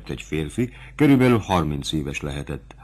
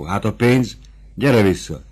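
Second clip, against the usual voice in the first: ordinary delivery, nothing marked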